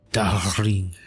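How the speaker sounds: a clumsy French lover's Darling; a self-mocking attempt at a French accent. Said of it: "I can hear you say it with your lovely French accent".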